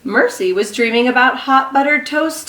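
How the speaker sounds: ordinary talk, nothing marked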